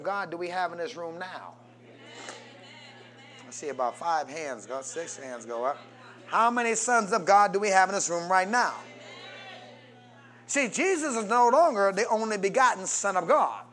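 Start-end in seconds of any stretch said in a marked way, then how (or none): none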